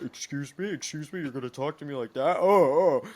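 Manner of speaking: high, loud indignant teacher voice; outraged teacher. Retold or imitated. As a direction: play the offended teacher, rising in outrage.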